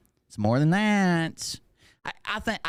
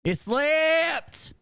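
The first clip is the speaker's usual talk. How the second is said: slipped shouted much higher, falling hard; hammed-up shriek on slipped; mock shout; 11 semitones higher than usual. Shown as a demonstration. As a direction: yell the word slipped in a shrill mock outburst. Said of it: "There's my mask".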